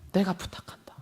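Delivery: whispered plea. Acted. Whispered scripture quote in sermon.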